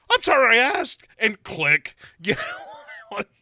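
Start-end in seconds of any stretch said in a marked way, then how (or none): 0.1-1.1 higher, louder hasty mock Sarah. [name] as Sarah cutting off the killer, higher and louder. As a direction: blurt it out high and quick before hanging up. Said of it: "she immediately is like".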